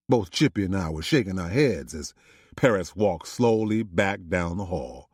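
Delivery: ordinary delivery, nothing marked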